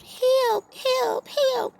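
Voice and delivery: very high, squeaky cries of help; a tiny trapped mouse screaming to be freed; mouse yelling for help. A narrator's reading. Trapped mouse yelling to anyone passing by, high squeaky cries. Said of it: "the mouse yelled".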